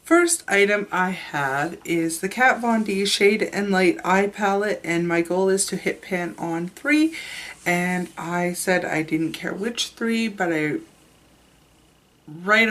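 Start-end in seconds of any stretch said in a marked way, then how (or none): none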